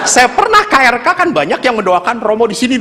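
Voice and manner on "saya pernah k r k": ordinary delivery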